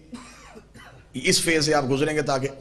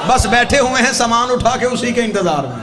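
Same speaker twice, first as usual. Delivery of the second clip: loud, raised sermon delivery; impassioned preaching; 5 semitones higher, 6 dB louder than usual. Real, not acted. Preacher swears to congregation he awaits death, loud impassioned preaching. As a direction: preach it out to the whole crowd.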